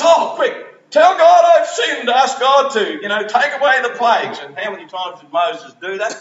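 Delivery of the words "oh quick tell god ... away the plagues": higher, louder frantic plea; a mock-desperate king begging in a hurry; panicked Pharaoh. Pharaoh begs for plagues' end.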